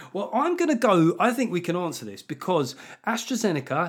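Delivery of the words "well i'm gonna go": usual speaking voice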